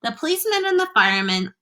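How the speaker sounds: plain reading voice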